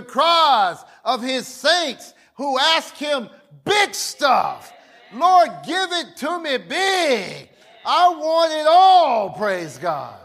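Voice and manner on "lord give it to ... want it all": bold prayer shout. Retold or imitated. Preacher voicing saints boldly asking God for big things.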